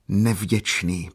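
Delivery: plain reading voice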